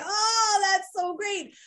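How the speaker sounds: high, delighted mock outburst; imitating screaming laughter. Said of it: "he starts screaming, laughing".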